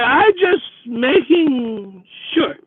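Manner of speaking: put-on high, slow, slurred drunk voice; suspicious drunk woman. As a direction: drag it out like a wary drunk.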